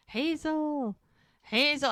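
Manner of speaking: high call across the woods; mild retold calling out. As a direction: call her name into the smoke. Searching for the lost kid.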